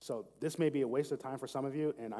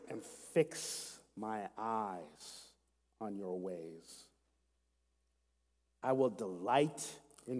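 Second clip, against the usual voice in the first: slow prayerful reading; much slower than usual. Shown as a demonstration. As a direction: pray the verses slowly, lingering on each phrase. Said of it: "I'm gonna read this slowly and prayerfully".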